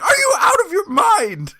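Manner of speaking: very high, loud incredulous shriek; whisper shrieking. Retold or imitated. Bandmate confronts singer over the Nazis, mock whisper-shriek. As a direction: demand answers in a strained mock shriek. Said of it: "I am literally whisper shrieking at him".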